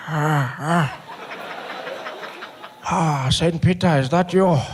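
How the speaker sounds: put-on slow, weak old man's voice, drifting off; a dying old man who thinks he sees heaven; frail Mandela. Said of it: "Mandela's there like".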